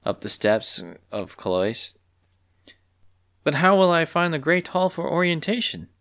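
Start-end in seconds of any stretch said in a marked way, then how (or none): 3.4-5.9 worried voice. A narrator's reading. Clucky worried.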